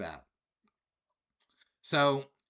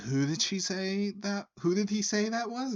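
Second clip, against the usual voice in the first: high-pitched puzzled question; imitated bar patron; 10 semitones higher than usual. Guy at the bar asks who the singer is, imitated. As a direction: pitch it well up, like a confused stranger at the bar. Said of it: "The guy next to me turns and goes".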